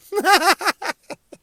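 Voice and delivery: high burst of laughter; high chuckling laugh; laugh at own joke. Real, not acted. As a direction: crack up at your own line. Laughs at his own analogy.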